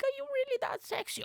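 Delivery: very high falsetto, swooning; high girly falsetto asking Mike; female voice. Acted. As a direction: gush it like an admirer in falsetto. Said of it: "this is the new female voice".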